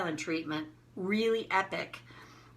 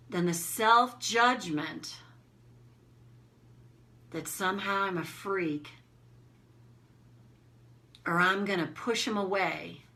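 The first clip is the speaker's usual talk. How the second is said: slow, measured guide with long pauses between phrases; guided self-reflection; 4 dB quieter than usual. Acted. Coach leading listeners to release self-judgment, slow guided reflection.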